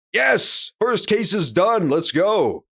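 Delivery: high, drawn-out victory cheer; elated and stretched out; real celebration. Real, not acted. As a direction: cheer the win, easing down at the end. Celebrating winning the case.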